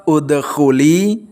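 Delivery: udkhuli, slow, the final li held for two beats; udkhuli with long li. Shown as a demonstration. Teacher modelling the long ending of udkhuli, slowly. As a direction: hold the li of udkhuli for two counts. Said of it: "dibaca panjang dua harokat".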